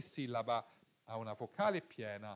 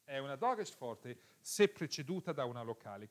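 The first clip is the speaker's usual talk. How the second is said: fast recap; faster than usual. Shown as a demonstration. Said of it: "quick repeat of rule".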